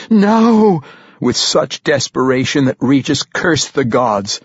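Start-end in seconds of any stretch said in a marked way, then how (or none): 0.1-0.8 high, loud anguished cry; horrified, drawn-out cry; desperate scream. A narrator's reading. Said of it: "she screamed".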